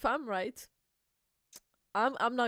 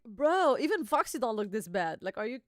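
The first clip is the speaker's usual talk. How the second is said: ordinary delivery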